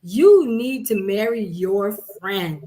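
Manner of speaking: ordinary talk, nothing marked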